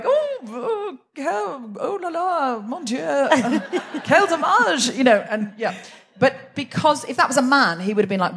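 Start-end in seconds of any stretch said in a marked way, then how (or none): 0.0-4.9 put-on hammy high French accent; flouncy, over-the-top French exclamations; french chef. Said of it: "she's just sort of going".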